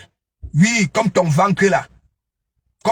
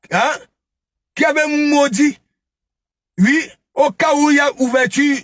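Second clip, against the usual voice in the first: higher, mocking gossip voice, telling on a rival; higher-pitched taunting storyteller, calling out "chercher, chercher, chercher"; mocking retelling; 6 semitones higher than usual. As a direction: lift the voice and sneer through the tale of her dreams.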